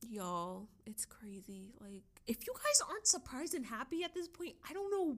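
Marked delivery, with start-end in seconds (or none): 0.1-2.0 breathless whisper, too overwhelmed to speak up. Real, not acted. Creator whispering to viewers, overwhelmed with excitement. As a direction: hush it out, awestruck by the excitement. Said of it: "I'm getting overwhelmed with excitement".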